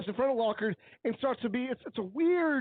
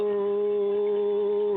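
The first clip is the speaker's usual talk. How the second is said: mock roar; 4 semitones lower, 4 dB louder than usual. Shown as a demonstration. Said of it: "That was a roar".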